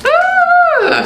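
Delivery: sudden high squeal of delight; excited squeal. Real, not acted. Excited squeal at the wig's weight. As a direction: squeal at the heavy wig. Unboxing heavy wig, squeals.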